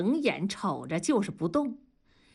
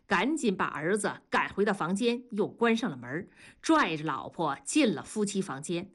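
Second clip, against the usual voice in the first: ordinary delivery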